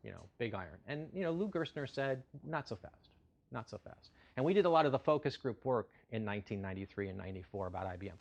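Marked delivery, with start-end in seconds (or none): 2.4-4.1 quiet. Retold or imitated. CEO quoted pushing back.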